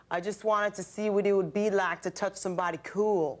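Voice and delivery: slow, creepy Southern drawl. Retold or imitated. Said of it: "in this slow, like, creepy Southern drawl".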